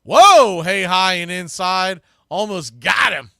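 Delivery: sudden loud burst on a close pitch; startled, loud play-by-play yelp; excited call. Real, not acted. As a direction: jolt up and shout the near miss. Near miss high and inside.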